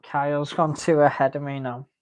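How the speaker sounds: normal talking voice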